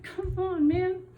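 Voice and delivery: high, loud playful groan at the blanket; mock exasperation. Crafter scolding her still-poofy blanket, mock exasperated. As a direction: whine it like the yarn let you down.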